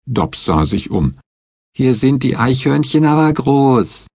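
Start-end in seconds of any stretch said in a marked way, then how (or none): 1.7-4.1 put-on high, girlish matter-of-fact voice; high naive child's remark; Betsy stating. A narrator's reading. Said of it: "stellte Betsy fest".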